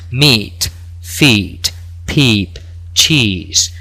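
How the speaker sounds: meat, feet, peep, cheese with a long ee; long i words. Shown as a demonstration. Teacher modelling the long vowel for class, drawn out. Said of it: "Se pronunciarían".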